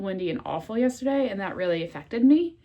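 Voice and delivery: usual speaking voice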